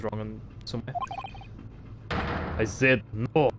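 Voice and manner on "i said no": snapping Russian sailor; irritated Russian accent; angry Russian accent. Acted. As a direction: snap at them in a Russian accent. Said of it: "I like to do Russian accents".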